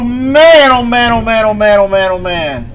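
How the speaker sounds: high loud shaken exclamations, calming down; rattled after a close strike. Real, not acted. Reacting to close lightning.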